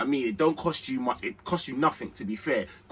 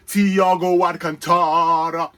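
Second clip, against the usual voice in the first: Thiago Alcantara drawn out slowly and louder; slow hyped name call; 3 semitones higher, 7 dB louder, slower than usual. Acted. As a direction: roll the name out like a stadium chant. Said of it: "Man, I say Thiago".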